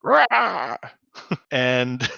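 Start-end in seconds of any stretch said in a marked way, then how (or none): 0.0-0.8 mock cry. Mock cry after the quote.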